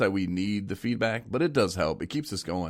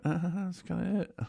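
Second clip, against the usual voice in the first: awkward stalling; 11 semitones higher, 5 dB quieter than usual. Retold or imitated. Reenacts running out of questions on air.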